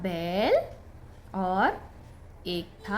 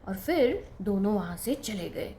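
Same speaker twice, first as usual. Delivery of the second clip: ordinary delivery, nothing marked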